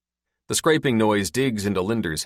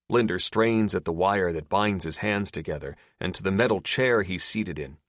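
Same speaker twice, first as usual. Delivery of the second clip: ordinary delivery, nothing marked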